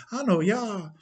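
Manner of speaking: high, obliging answer; deferential actor. Acted. Actor confirms his role to chief, deferentially. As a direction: answer a patron eagerly and politely.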